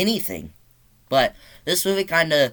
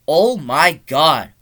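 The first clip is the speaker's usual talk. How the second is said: slow, louder, punched word by word; emphatic amazement; 2 semitones higher, 7 dB louder, much slower than usual. Real, not acted. Reviewer marvelling at the gore, slow loud amazement. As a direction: hit each word hard and slowly. Exclaims over the film's gore.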